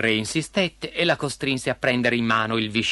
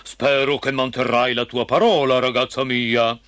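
thundering king. A narrator's reading. King holding his daughter to her word, thundering sternly. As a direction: speak as a commanding royal father. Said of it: "tuonò il re".

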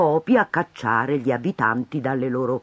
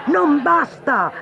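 high, slow, loud outcry; crowd shouting; 12 semitones higher, 4 dB louder, slower than usual. Acted. Townsfolk shout down the mayor, loud and high. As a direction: bellow it back, much higher. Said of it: "vociò la gente".

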